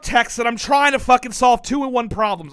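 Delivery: loud, high-pitched protest; defensive outburst. Real, not acted. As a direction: shout back in exasperated self-defense. Defensive outburst when accused of phone obsession.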